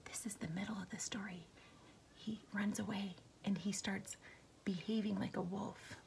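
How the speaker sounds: soft whisper to class. Real, not acted. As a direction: whisper the recap. Teacher whispered aside.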